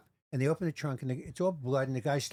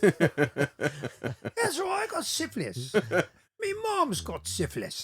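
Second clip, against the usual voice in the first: put-on cheery English accent, slow and sing-song; chirpy English, breezy about bad news; upbeat English accent; 6 semitones higher, much slower than usual. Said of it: "people from England always sound upbeat".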